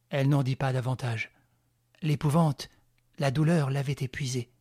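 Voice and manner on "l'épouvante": plain reading voice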